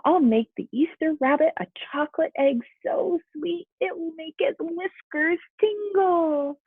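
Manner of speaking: high, hopeful little rabbit; eager bunny voice. Acted. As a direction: dream it out loud like a proud bunny. Hoppy voiced, eager plan.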